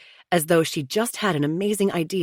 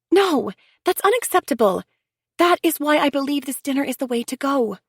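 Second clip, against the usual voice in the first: higher, earnest sister-in-law pleading for unity; Chelsea, insistent; 10 semitones higher, 3 dB louder than usual. A narrator's reading. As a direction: press them to sit together like family. Chelsea voiced, firm refusal.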